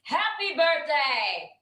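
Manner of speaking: high, drawn-out happy birthday greeting; real excited greeting. Real, not acted. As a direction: burst in with a high birthday cheer. Walks in with surprise birthday greeting.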